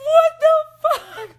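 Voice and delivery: a very high, loud, slow laughing cry; shocked shriek. Retold or imitated. Retelling a spirit charging at her, shocked laughing shriek. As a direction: shriek it in disbelief, half laughing.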